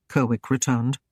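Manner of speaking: usual speaking voice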